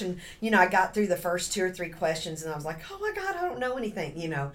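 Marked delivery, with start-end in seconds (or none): 2.9-4.2 panicked. Retold or imitated. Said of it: "You think that 'cause it's very scary".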